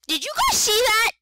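high, loud shocked cry; shocked shout. Real, not acted. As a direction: yell it in real shock. Repeats louder after jump scare.

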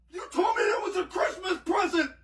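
high, wailing complaint; upset protest. Real, not acted. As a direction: cry out feeling tricked. Pranked grandson protests, hurt.

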